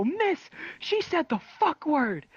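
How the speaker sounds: high, drawn-out tattletale whine; exaggerated high, slow telling-on; mock tattling. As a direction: tattle slowly in a squeaky schoolkid voice. Gleefully tattling on classmate.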